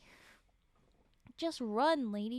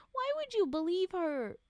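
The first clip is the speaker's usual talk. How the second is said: incredulous; 6 semitones higher, 6 dB louder than usual. Real, not acted. Reader argues with story.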